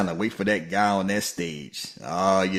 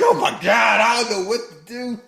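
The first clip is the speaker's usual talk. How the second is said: mock flustered woman; 13 semitones higher, 5 dB louder than usual. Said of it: "she like".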